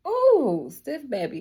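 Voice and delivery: high, loud sassy ooh; sassy teasing. Acted. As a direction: tease them about stiffness. Teasing about stiff body.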